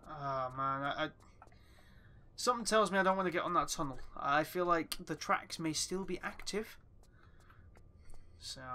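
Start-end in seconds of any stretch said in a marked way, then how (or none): none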